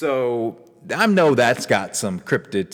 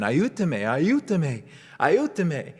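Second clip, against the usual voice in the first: high-pitched mock cry for help, no real volume; retold scream; 7 semitones higher than usual. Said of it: "I was screaming".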